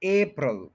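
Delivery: April as AY-prul, stressed on AY; first-syllable stress. Shown as a demonstration. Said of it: "first के ऊपर stress है". (अप्रैल में "ए" पर ज़ोर, "ए-प्रल")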